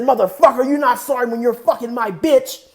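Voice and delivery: loud, high acted fury; heated mock outrage; angry retort. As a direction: throw the apology back in his face, yelling.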